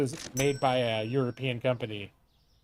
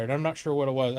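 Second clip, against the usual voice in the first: ordinary delivery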